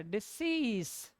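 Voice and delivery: डिसीज drawn out slowly, di-sees with clear s sounds; slow demonstration. Shown as a demonstration. Teacher repeating the right word slowly for the class. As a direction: stretch out डिसीज, stressing the s. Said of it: "ये सीस है".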